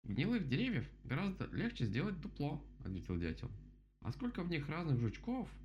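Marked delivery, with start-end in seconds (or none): 0.0-2.6 high-pitched storybook bird; voiced woodpecker character. A narrator's reading. Woodpecker approves the dam, high bird voice. 4.0-5.5 high-pitched storybook bird; voiced woodpecker character. A narrator's reading. Woodpecker approves the dam, high bird voice.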